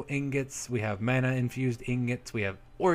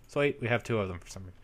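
ordinary talk

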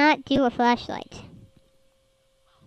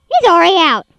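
louder panicked exclamation; alarmed loud repeat; 4 semitones higher, 11 dB louder than usual. Real, not acted. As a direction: shout it in alarm.

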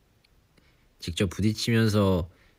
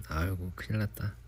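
normal talking voice

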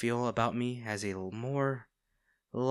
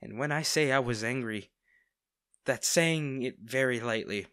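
usual speaking voice

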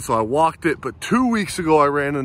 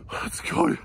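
whispered cheer; 12 semitones higher, 6 dB quieter than usual. Real, not acted. Whispered celebration.

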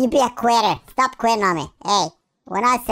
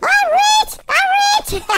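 very high, loud squeal of glee; gleeful gloating; 5 dB louder, slower than usual. Acted. Player finding money, high gleeful gloating. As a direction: squeal it out as if striking gold.

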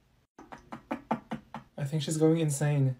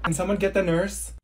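usual speaking voice

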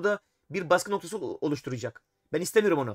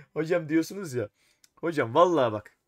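normal talking voice

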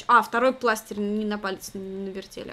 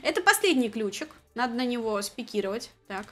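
ordinary talk

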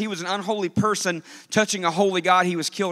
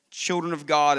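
ordinary talk

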